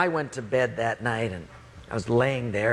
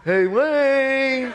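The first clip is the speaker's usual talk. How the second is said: high, drawn-out singsong call; Mike; 10 semitones higher, 5 dB louder than usual. Retold or imitated. Roommate calling sleeper through door, high pestering call. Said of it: "And it's Mike".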